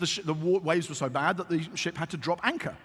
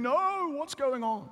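mock dismay; 8 semitones higher, slower than usual. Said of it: "they would have been saying".

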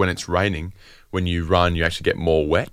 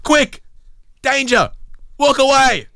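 high, loud mock alarm; alarmed shout; 3 dB louder, slower than usual. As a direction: yell a hammed-up warning. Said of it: "whoever said".

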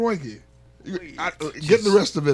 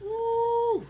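much higher whoop; high excited whoop; cheering woo; 11 dB quieter than usual. Real, not acted. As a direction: cheer the shot. Cheering the miracle shot story.

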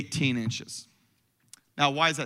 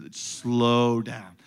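low, stretched-out "slow down"; deliberately slow; 7 semitones lower than usual. Shown as a demonstration. Preacher coaching congregation on reading Scripture, very slow and low. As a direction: draw out "slow" to show the pace. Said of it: "you need to read it slow".

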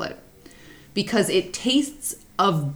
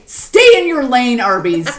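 loud scolding; 4 semitones higher, 7 dB louder than usual. Acted. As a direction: raise your voice, scold Arby's sharply. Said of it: "and I say".

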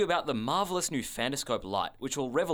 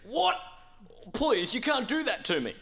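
high, desperate begging; panicked pleading; 11 semitones higher than usual. Acted. Pleading against purgatory sentence.

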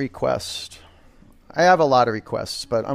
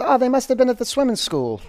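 high, bright mock voice of an impressed stranger; imagined onlooker; 9 semitones higher than usual. Coach imitates strangers guessing the class went swimming. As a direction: pipe up like a passer-by who's fooled.

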